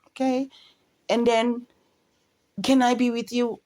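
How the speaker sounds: high, hesitant mock follower; mocking Jojo tagging along behind; timid follower voice. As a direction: ask meekly like a tag-along.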